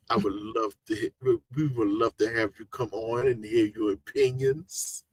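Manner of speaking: slightly hammed-up reverent request; mock-gracious invitation. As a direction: play at formal politeness, hushed and careful. Mock-formal invitation to a guest.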